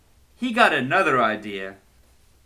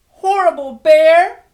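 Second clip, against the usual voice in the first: loud, high furious yell; angry shout; 13 dB louder than usual. Acted. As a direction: shout it at the bear in anger. Girl shouting title phrase.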